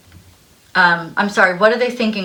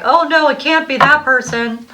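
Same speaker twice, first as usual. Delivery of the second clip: mock dismay; 7 semitones higher, 4 dB louder than usual. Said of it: "And you're like".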